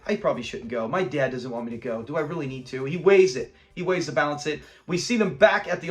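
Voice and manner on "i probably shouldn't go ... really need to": low, soft musing; hushed, lowered voiced thought; inner doubt. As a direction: think aloud quietly, as if to yourself. Voices son's private doubting thoughts.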